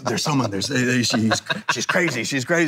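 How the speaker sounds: hammed-up panicked groom; shaking friend. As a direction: imitate the groom's frightened stammer. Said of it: "he's shaking".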